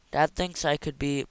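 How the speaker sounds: ordinary delivery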